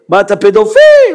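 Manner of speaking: very high accusing shout; imitated angry yell. Bank manager yelling at the customer before security, high shout. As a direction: shriek the accusation in mimicked outrage. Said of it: "צריך לצעוק עליי גם".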